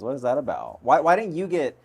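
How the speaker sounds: normal talking voice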